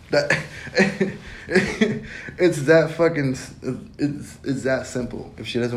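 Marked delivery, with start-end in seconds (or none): 0.4-2.3 high breathy giggle; unvoiced burst of laughter; airy laugh. Real, not acted. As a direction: laugh at how simple it is. Laughs mid-advice.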